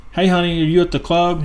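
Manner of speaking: lightly voiced wife calling her husband; joke's wife on phone. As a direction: put on a hint of a sweet-talking wife. Wife phoning in a joke.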